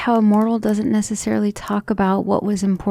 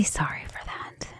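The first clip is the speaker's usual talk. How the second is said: whispered apology; 11 dB quieter than usual. Acted. Apologizing to lover after breakdown.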